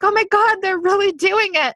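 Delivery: thrilled, high-pitched retold gasp; excited. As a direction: squeal it like proud news for a friend. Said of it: "'cause I'm like".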